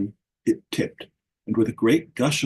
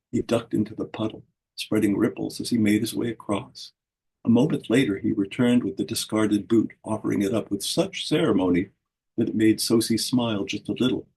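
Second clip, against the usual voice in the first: ordinary delivery, nothing marked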